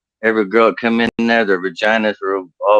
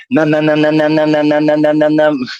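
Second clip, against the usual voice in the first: higher, droning run of "numb" on one held pitch; comic "numb-numb" noise; 6 semitones higher than usual. Acted. Gynecologist acting out the numbing, droned high on one note. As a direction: chant it nonstop without a breath.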